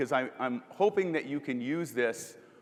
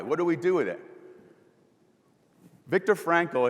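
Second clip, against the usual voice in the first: normal talking voice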